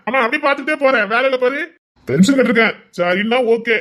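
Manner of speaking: loud teasing. Acted. Husband teasing loudly.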